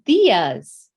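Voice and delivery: diyas said clearly as the reveal; answer reveal. Shown as a demonstration. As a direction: present the word diyas with weight. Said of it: "The answer is".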